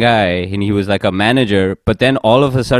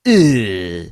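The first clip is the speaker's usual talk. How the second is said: higher, lightly put-on accent of the Shanghai manager; a hint of his accent, annoyed; mock accent; 7 semitones higher than usual. Imitating the Shanghai manager on the call, light mock accent. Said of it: "I'm not doing his accent".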